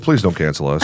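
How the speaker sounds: ordinary talk, nothing marked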